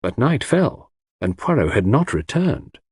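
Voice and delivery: ordinary delivery, nothing marked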